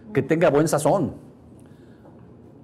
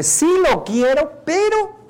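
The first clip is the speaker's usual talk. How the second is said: put-on high girlish voice, pitched way up; voz de chica; 12 semitones higher, 4 dB louder, slower than usual. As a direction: play the girl, hesitant and high. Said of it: "dice la chica a nivel inconsciente". (voz aguda de chica, dudosa)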